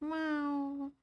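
soft high kitty mew; gentle pretend cat call; cat meow. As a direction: give one long quiet meow. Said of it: "I am on my cat form today".

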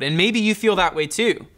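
ordinary talk, nothing marked

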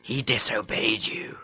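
slow, hissed whisper; creepy whisper. Pretend. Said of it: "Sorry about the creepy pervert voice".

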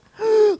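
sharp loud gasp; shocked gasp. Acted. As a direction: gasp as if caught out. Shocked gasp at own thought. (깜짝 놀라 숨을 들이켜는 소리)

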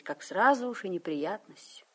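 normal talking voice

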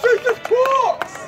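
high, drawn-out scream; stretched high cry; screaming the name. Shown as a demonstration. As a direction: scream it long and high as a call for help. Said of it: "just scream that name".

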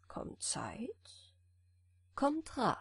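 soft scheming whisper. A narrator's reading. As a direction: breathe it as a secret plan. Giant's secret thought. (geflüstert)

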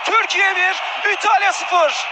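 goal call at the top of the voice; screaming football commentator. Real, not acted. Turkish goal call, commentator erupts.

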